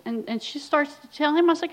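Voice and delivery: normal talking voice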